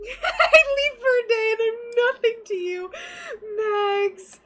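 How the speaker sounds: high, loud hammed-up whine; mock hurt complaint. Reading a viewer's jealous message to Max, mock hurt shout. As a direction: play wounded, hamming it up.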